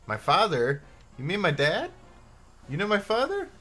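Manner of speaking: quoting a game character. Acted. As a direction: quote the famous line with a hammed-up shock. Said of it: "the famous line from this game".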